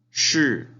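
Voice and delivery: "shi" high and loud, plunging down; teacher's emphatic falling "shi". Shown as a demonstration. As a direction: slide "shi" sharply downward. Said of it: "el último tono hacia abajo".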